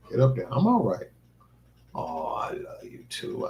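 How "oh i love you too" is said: slow whispered love to a pet; whispered, tender, unhurried. Real, not acted. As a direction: breathe it softly to the dog. Tender words to dog.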